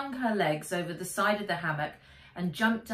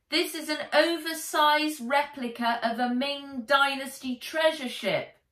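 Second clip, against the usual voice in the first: louder, higher shout; shouting back; 7 semitones higher, 5 dB louder than usual. A narrator's reading. Nat defending her boat to the policeman, shouting. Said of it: "she shouted".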